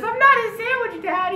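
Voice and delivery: very high, loud squealed protest; crying-laughing kid. Retold or imitated. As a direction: squeal a denial in mock hysterics. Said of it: "Dar just starts crying, laughing".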